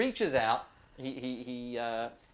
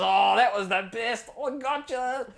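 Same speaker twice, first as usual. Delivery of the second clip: higher, louder easygoing Aussie ranger giving a tour; 10 semitones higher, 10 dB louder, slower than usual. Acted. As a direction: play the laid-back outback ranger showing the spot.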